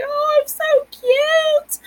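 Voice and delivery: put-on very high, slow cooing over her own baby pictures; gushing at baby photos. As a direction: coo it slowly, way up high. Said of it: "and I'm like".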